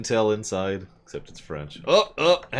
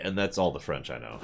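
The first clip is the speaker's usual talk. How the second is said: normal talking voice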